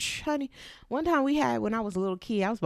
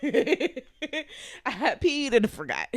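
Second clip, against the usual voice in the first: laughing; 4 semitones higher, much slower than usual. Real, not acted. Laughing at herself.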